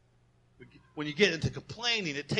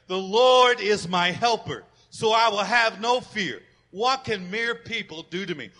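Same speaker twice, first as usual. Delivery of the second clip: loud, slow group recitation; congregational recitation; 3 semitones higher, 7 dB louder than usual. Acted. Pastor leading the congregation in the verse, loud. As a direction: lead the church in saying it. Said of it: "Let's say this part together".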